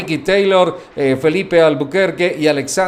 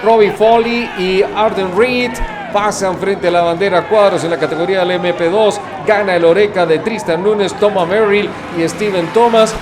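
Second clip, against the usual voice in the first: motorsport commentator; 3 semitones higher than usual. Acted. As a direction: call the checkered flag with rising excitement. Finish-line race call.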